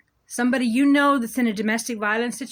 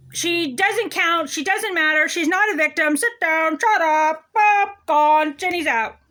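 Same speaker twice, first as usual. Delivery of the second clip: a hint of a dismissive judge, higher and louder; mocking judge; 7 semitones higher, 5 dB louder, slower than usual. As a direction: bark out the brush-off like a bored judge. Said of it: "Okay, so Judge Remsen".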